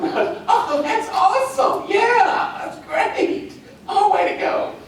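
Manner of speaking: high, gushing, laughing fake cheer; fake enthusiasm. Shown as a demonstration. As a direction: overdo the delight so it rings hollow. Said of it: "Fake enthusiasm".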